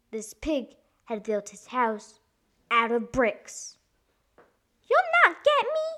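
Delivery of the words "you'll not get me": high, loud squeaky pig; high-pitched storybook pig shout; crying-out pig. A narrator's reading. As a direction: cry out defiantly as the piglet. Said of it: "the pig cried".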